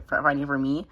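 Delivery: ordinary talk, nothing marked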